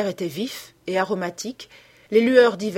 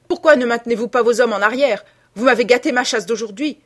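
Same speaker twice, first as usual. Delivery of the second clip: loud, pitched-up angry tirade; furious; 5 semitones higher, 8 dB louder than usual. A narrator's reading. Hunter blaming the keeper for his spoiled shoot, furious. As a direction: berate him at full voice. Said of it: "répondit furieusement sir Geoffrey".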